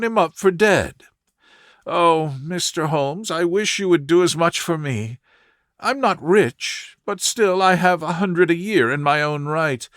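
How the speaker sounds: a worried client begging Holmes for help; earnest young woman. Acted. Narrator voices Mary Sutherland.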